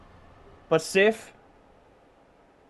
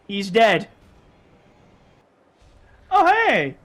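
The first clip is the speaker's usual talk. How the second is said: ordinary talk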